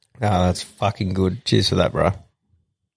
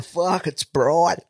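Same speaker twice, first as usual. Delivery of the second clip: slow, higher dopey complaint; imitating clueless bloke; 7 semitones higher, much slower than usual. Host mocks the backwards-cap bloke, slow dopey whine. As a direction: drag it out like a squinting idiot. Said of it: "mate, going".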